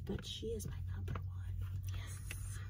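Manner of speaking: soft secret confession; whispering. Real, not acted. Confiding her favourite character.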